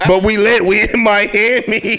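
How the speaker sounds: put-on high, slow party-girl brag; mock girl bragging; sassy girl voice. Continues girl impression.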